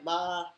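"Ma" held high and level; first tone. Shown as a demonstration. Teaching the first tone to listeners, high and level. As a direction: sing "Ma" on one high flat pitch. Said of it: "the first tone is high".

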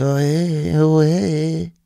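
high, slow, put-on voice of a shifty stranger; coronavirus as a sneaky foreign stranger. Comedian voicing the coronavirus as a person, high shifty put-on voice. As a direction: play a shady guy pretending not to speak English. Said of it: "it would probably talk like this".

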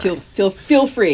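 loud, drawn-out permission; eager. Real, not acted. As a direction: feel feel free, grinning. Host eagerly permits cursing.

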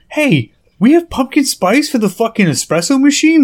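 high, eager mock question about pumpkin spice; hammed-up delight at smelling pumpkin spice; excited question. Housemate coming downstairs hoping for espresso flavour, high and eager. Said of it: "you come downstairs and you're like".

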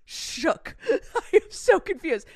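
amazed. Real, not acted. Stunned by backwards talent.

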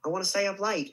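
put-on child's stage line in an Australian accent. Shown as a demonstration. Recalling a forgotten stage line as a nine-year-old, Australian accent. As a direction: say it Australian, "late" sounding like "light". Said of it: "In an Australian accent".

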